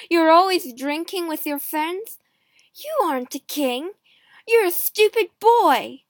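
loud, rising to a high insult; scornful anger. A narrator's reading. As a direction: build up to the insult. Queen insults husband in quarrel.

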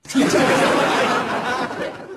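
high rising laugh; high giggle. Real, not acted. Laughs at own joke.

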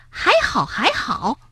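high, indignant echo; cross. A narrator's reading. Bengbeng mocking the friend's excuse, cross. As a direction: throw it back crossly in a high voice. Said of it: "蹦蹦生气地说".

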